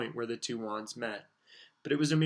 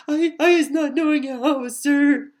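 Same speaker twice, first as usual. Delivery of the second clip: very high squeaky elf voice, gasping in panic; a terrified little elf gasping out her denial; gasping house-elf; 13 dB louder, slower than usual. Acted. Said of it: "Winky gasped".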